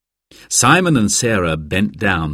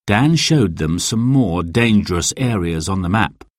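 plain reading voice

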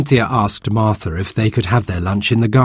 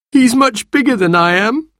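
sniffing; 13 semitones higher than usual. A narrator's reading. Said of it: "he sniffed".

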